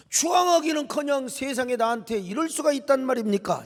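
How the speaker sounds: protesting. Acted. Moses protesting his calling to God, aggrieved. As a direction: argue back, building from questions to outcry. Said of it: "하나님께 항변을 하는 거예요".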